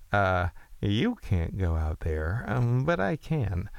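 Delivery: slow, drawn-out retort; labored imitation at half speed; uncool Don Draper. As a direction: stretch the words out, very deliberately. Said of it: "he didn't say it like that".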